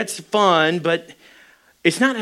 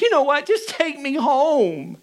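tearful wife; 10 semitones higher than usual. Retold or imitated. Crying wife to husband at the door, mock tearful. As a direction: imitate a crying wife, slowing on the last words. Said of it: "she starts crying".

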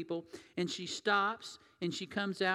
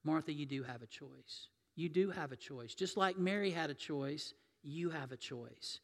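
gentle reminder; 2 semitones lower, 5 dB quieter than usual. Retold or imitated. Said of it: "Jesus reminds her".

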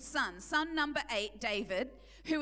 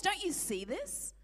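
ordinary talk

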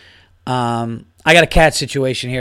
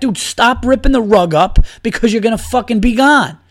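raised, exasperated scolding; scolding the cat; 9 semitones higher, 5 dB louder than usual. Retold or imitated. Owner scolding his cat for ripping the rug. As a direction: warn the cat off, fed up. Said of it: "I wanna say to the cat".

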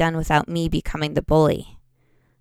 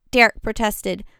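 usual speaking voice

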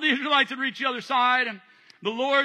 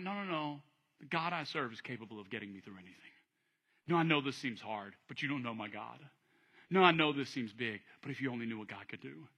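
much lower, soft and steady; calm assurance; 9 semitones lower, 9 dB quieter than usual. Acted. Believer in hard times, calm and certain. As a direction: quietly insist God is able. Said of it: "You find yourself going".

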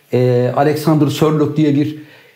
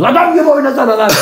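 higher, louder mock scold; 10 semitones higher, 3 dB louder, faster than usual. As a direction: snap at him to play properly. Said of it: "fırça attınız".

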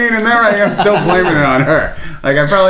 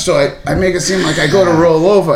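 ordinary delivery

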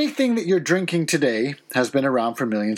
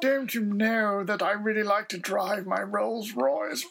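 high, hammed-up posh English; plummy, raised posh voice; posh accent; 5 semitones higher than usual. Shown as a demonstration. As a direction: show off like a wealthy toff. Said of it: "It's a posh accent".

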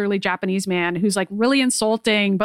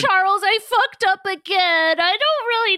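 whiny Logan; 10 semitones higher than usual. Retold or imitated. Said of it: "And he's like".

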